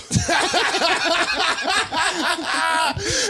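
high burst of laughter. Real, not acted. Laugh at rhetorical.

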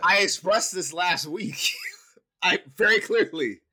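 loud, high, drawn-out insistence; emphatic protest. Real, not acted. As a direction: insist loudly, slow and high, laughing partway. Heated playful defence, talking back.

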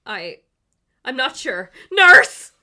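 rising into a loud high yell; panicked call for a nurse. Acted. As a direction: shout down the corridor for help.

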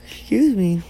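talking through a yawn. Real, not acted. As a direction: yawn as you say it. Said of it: "it's always when I'm podcasting, I yawn".

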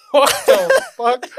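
high, loud and slow disbelief; incredulous outburst. Retold or imitated. Recalling his reaction to a friend's outburst, incredulous yell. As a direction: blurt it out loud, dragging the words.

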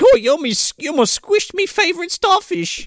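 high, put-on leprechaun voice; Lucky. Lucky complaining about his starfish, high put-on voice. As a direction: squeal it in a mock Irish lilt. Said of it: "Lucky runs over and he's like".

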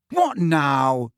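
impatient, aggrieved question. A narrator's reading. Harry to nearest teammate after the whistle, impatient. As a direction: ask it fed up at being interrupted. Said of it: "he said impatiently to Alicia".

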